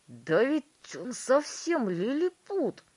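high, loud, slow astonishment; exclaiming. A narrator's reading. Father seeing his shrunken son, exclaiming. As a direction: exclaim it, drawing the words out. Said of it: "воскликнул мистер Тиви".

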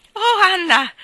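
high, loud breathless cry of joy; breathless exclamation. A narrator's reading. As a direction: gasp it out, overjoyed for your friend. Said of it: "esclamò Jane senza fiato".

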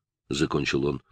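ordinary delivery

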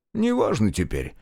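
ordinary delivery, nothing marked

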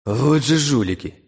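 slow, bitter hatred; hateful. A narrator's reading. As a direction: spit it out in contempt. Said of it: "с ненавистью подумал я".

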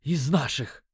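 strained, broken by a gulp for air; gasping rasp. A narrator's reading. Punched dealer catching his breath, rasping a confession. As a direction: choke it out in two winded bursts. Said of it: "прохрипел он, хватая ртом воздух".

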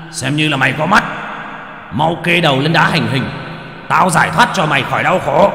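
high booming executioner voice; booming like thunder. A narrator's reading. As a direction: boom it out like thunder. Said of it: "giọng oang oang như sấm". (giọng oang oang như sấm)

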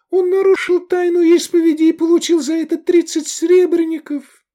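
very high, loud pleading; shrieked warning in a girl's voice; girl crying out. A narrator's reading. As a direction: plead desperately with the crowd at full cry. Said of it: "закричала девушка".

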